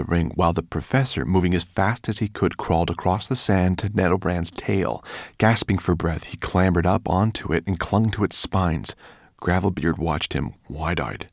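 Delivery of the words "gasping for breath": ordinary delivery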